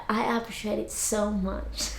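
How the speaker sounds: very soft, near-whispered gratitude; hushed thanks. Real, not acted. Soft thanks, then repeated louder.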